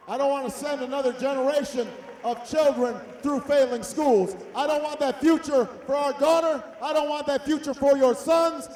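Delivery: loud, driving campaign oratory; rally speech. Real, not acted. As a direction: hammer each refrain to the crowd.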